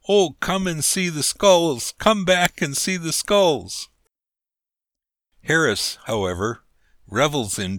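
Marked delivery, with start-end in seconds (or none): 0.1-3.9 loud, high call after someone. A narrator's reading. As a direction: shout after a man running away. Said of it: "I heard him calling to me".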